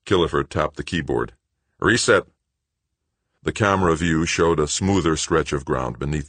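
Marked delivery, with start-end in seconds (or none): 1.8-2.3 crisp confirmation. A narrator's reading. Killefer confirming.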